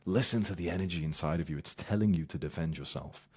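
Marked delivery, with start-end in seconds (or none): none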